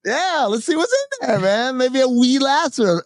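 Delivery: high, animated inner voice; retold eager anticipation. Storyteller imagines who is in the other room, eager. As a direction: act out hopeful curiosity. Acting out his eager thoughts.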